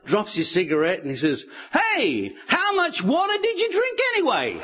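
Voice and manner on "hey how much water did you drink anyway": very high, incredulous punchline voice; mock astonishment. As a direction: exclaim it high, as a story koala in disbelief. Koala's astonished punchline to crocodile.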